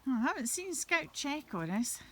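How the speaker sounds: ordinary delivery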